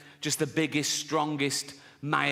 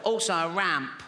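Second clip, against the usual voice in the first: put-on funny little boy voice; comic put-on child with a bad chest; Timmy; 3 semitones higher than usual. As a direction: pipe up as a sickly small child. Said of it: "a funny little voice".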